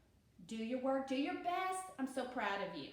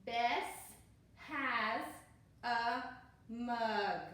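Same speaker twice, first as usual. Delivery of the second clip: each word read slowly and separately, louder; slow, word by word read-aloud for beginners; 4 dB louder, much slower than usual. Shown as a demonstration. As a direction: point and read one word at a time. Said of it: "use your finger".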